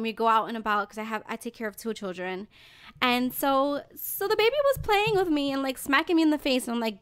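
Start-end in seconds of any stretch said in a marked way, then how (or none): none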